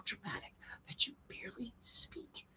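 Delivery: breathy hush to mock the actors; mocking whisper acting. Shown as a demonstration. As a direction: whisper it in mockery. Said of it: "whisper acting".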